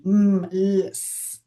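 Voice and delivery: "mm", "l" and "s" said as bare sounds, not letter names. Shown as a demonstration. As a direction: hum "mm", then a lone "l", then a voiceless "s". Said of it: "uno no habla así".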